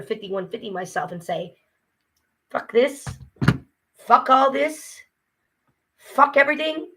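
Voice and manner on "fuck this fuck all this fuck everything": higher, punched-out cursing; fed-up outburst. Acted. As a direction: curse it all, rising in pitch. Imagines giving up on everything.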